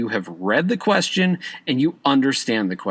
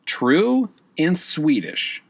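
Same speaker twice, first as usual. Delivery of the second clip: true and Swedish said slowly, each word set apart; slow key words; 3 semitones higher, much slower than usual. Shown as a demonstration. Teacher to exam students, slow stressed key words. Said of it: "the two key words there".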